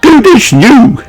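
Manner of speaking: high, pleased line; proud rabbit lady. Acted.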